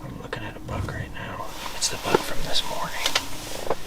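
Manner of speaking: hushed whisper watching deer; wildlife watching whisper. Real, not acted. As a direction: breathe it out quietly on stand.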